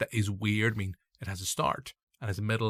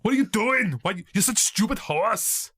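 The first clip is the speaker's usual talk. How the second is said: high, loud put-on scolding shout; film hero scolding; 9 semitones higher, 6 dB louder than usual. Film hero to his horse, hammed-up angry shout. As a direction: berate it like an action hero. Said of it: "what he says to the horse".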